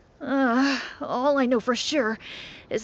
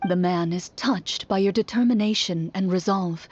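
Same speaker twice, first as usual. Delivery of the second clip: game narration; 4 semitones lower than usual. A narrator's reading. Game narrator describes the man.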